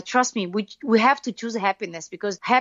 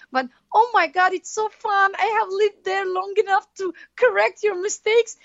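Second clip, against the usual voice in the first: gleeful gloat; 11 semitones higher than usual. Retold or imitated. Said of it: "I'm like".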